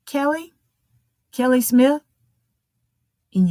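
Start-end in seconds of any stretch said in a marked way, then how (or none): 0.1-2.0 higher, questioning call for a patient; waiting-room name call. Acted. Doctor's office calling the next patient in, questioning call. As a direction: call out the name with a rising lilt. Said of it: "they come and they open the door".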